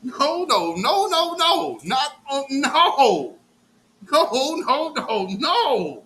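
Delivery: loud, high repeated refusal; emphatic no. Real, not acted. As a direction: hammer each no home.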